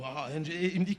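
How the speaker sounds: ordinary delivery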